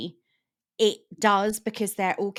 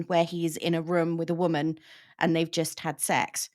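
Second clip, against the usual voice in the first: usual speaking voice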